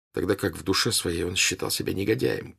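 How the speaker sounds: ordinary delivery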